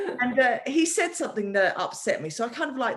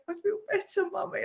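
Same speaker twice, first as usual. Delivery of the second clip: put-on young son voice; 9 semitones higher, 3 dB quieter than usual. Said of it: "He goes".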